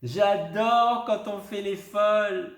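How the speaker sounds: high, loud, slow and proud; announcing it to everyone; trumpeting. A narrator's reading. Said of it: "claironne Lou". (claironner, fort et aigu)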